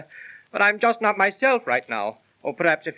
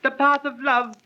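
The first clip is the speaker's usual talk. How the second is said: stage verse; 6 semitones higher than usual. Acted. Actor speaks his stage lines.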